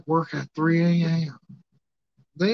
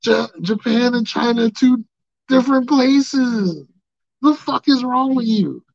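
high, loud, slow indignant correction; high loud mock outrage at the fan; exasperated retort; 8 semitones higher, 5 dB louder, slower than usual. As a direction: set the fan straight, fed up. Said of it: "I'm like".

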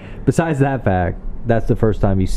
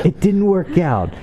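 mock disappointed villain; 10 semitones higher than usual. Mocking endless revenge plots.

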